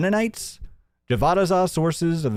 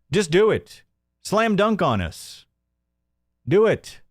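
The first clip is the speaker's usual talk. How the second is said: taunting callout; 6 semitones higher than usual. Real, not acted. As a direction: call him out, daring him to show it. Taunting the owner to prove it.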